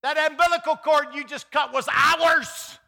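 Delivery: high, loud, half-laughing protest of a new mother; mock possessive mother. Pastor imitates a new mother protesting as her baby is taken. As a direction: ham up a mother clinging to her newborn. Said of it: "you could almost hear her saying".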